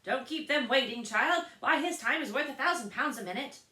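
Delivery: loud, high scolding crowd; chorus of voices. A narrator's reading. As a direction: nag her like a whole carriage at once. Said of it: "a great many voices all said together".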